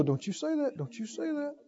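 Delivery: very soft, checking voice; hushed restraint. Acted. The Lord stopping the preacher mid-outburst, hushed and firm. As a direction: rein someone in under your breath. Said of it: "get a hold of me and go".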